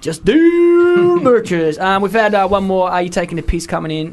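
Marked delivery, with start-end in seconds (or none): 0.0-1.8 very slow, stretched and higher; drawn out. Acted. Co-host joking about the show name, very slow stretched delivery. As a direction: drag the word out for a laugh.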